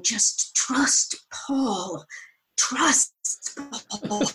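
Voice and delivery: self-reassurance. Retold or imitated. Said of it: "have this moment of saying".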